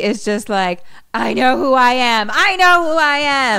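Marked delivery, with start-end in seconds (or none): 1.1-2.3 raised, insistent acted protest; mock protest. Mickey protests to Robbie D who he is, mock protest. Retold character's desperate insistence. 2.3-3.6 high, loud acted outburst; escalating mock protest. Mickey insists harder to Robbie D, escalating mock protest.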